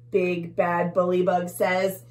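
usual speaking voice

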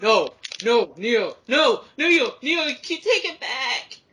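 high, loud shout at Neo; real shouted plea, slowing at the end; shouted protest. Real, not acted. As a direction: yell take it back at the rival racer. Rival stole item.

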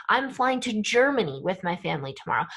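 "Germany" accented, the rest quick; single out "Germany" with your voice; stress on Germany. Shown as a demonstration. Teacher demonstrating emphasis in English, stress on "Germany". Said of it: "we would use our voice".

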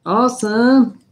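high loud cheer; excited. Real, not acted. Celebrating giveaway win.

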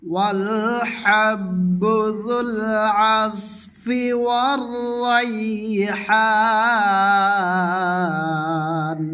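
slow, drawn-out melodic tilawat; slow chanted Quranic recitation. Shown as a demonstration. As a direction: chant the verse slowly, stretching the vowels. Teacher recites the verse melodically.

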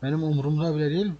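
ordinary delivery

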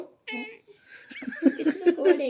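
cooing. Real, not acted. Cooing over the cat.

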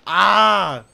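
sudden loud startled yell. Real, not acted. Gamer startled by a jump scare, yelling.

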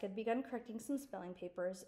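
plain reading voice

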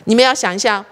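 ordinary talk

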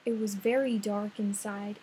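ordinary delivery, nothing marked

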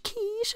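quiche ends in a soft sh. Shown as a demonstration. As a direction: say quiche, hissing the sh. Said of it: "C H that sounds like a sh".

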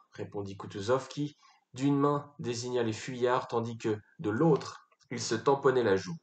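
usual speaking voice

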